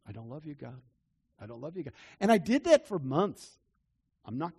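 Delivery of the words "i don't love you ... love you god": soft, lower, broken-hearted murmur; broken confession. Retold or imitated. Preacher imitating his humble confession to God, soft and broken. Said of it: "out of a broken, passionate heart".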